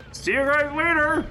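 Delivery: high, cheery goodbye; squeaky see-you-later; jaunty farewell. Acted. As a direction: toss it off breezily before jumping. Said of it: "and says".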